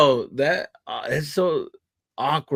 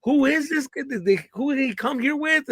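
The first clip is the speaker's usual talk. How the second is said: much higher, baffled questioning; suspicious crew; 12 semitones higher than usual. Retold or imitated. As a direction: demand to know who let him in. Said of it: "now they're like".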